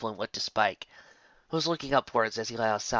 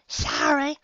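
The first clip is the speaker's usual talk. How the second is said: plain reading voice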